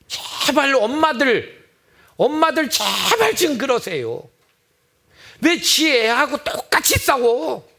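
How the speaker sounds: high, slow exasperated plea; pleading. Acted. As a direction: beg the mothers to stop. Pleading with mothers.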